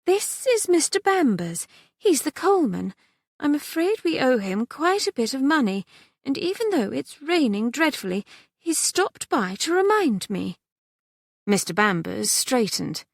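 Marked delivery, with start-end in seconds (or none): 0.1-10.6 worried mother. A narrator's reading.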